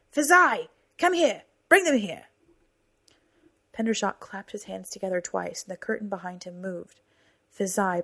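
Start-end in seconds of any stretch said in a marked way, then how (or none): 0.1-2.2 loud, high commanding call; shouting. Acted. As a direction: bark the order across the hall. Calling out to servant.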